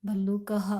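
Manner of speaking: भल्लुकः ending with a light ह after अ, said as bhallukah; भल्लुकः: let the final visarga sound as ह; visarga pronounced. Shown as a demonstration. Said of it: "मैंने क्या बोला". (भल्लुकः को अंत में ह की ध्वनि के साथ बोलें)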